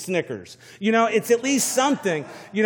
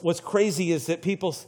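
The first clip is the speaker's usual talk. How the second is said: usual speaking voice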